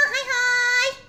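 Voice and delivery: ordinary talk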